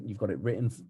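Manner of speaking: ordinary delivery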